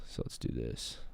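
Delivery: low whispered murmur. Real, not acted. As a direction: breathe it out under your breath. Hyping himself up for the ranking.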